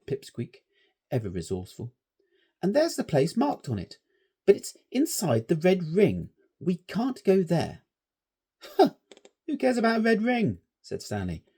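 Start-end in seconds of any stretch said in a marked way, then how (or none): 2.6-7.8 put-on higher, louder worried boy; a timid scout warning his friends; anxious schoolboy. A narrator's reading. Cautious scout voiced. 8.6-10.6 much higher, louder scornful schoolboy; brash boy dismissing the red ring; scoffing boy. A narrator's reading. Stanley scoffs.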